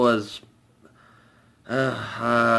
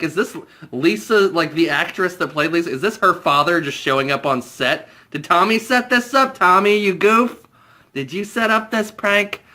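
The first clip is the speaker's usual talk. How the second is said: ordinary talk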